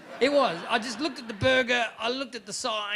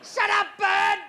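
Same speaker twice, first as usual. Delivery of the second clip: higher, loud snap at the bird; yell at bird; 10 semitones higher, 4 dB louder than usual. Retold or imitated. Sleepless man yelling at a bird, angry yell. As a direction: bark it at the window.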